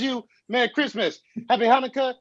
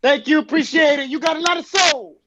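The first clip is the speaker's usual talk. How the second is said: sarcastic shout; 12 semitones higher, 9 dB louder than usual. Acted. Beggar yells after being shoved.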